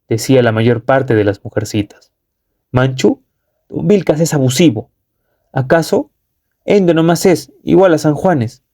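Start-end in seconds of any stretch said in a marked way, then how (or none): 2.7-8.6 higher, taunting challenge; defiant shout. A narrator's reading. Hualpa taunts don Vilcas, higher defiant shout. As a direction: hurl the challenge at the chief. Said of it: "gritó desafiando don Hualpa".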